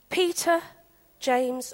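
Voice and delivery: usual speaking voice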